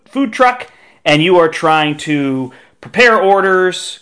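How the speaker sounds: ordinary talk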